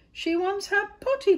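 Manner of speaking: very high, loud shout; cried out. A narrator's reading. Gardener passing the call along, loud high cry. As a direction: holler it across the garden. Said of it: "cried the gardener".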